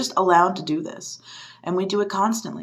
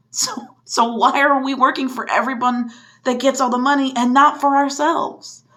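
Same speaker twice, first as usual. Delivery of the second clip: rising frustration; 4 semitones higher than usual. Real, not acted. Rant rising to outrage.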